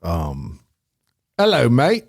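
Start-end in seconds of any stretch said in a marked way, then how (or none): 1.4-2.0 put-on a light British accent, lifted a touch; chirpy British greeting in a mock accent; a quick mock British hello. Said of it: "Give us, give us the accent".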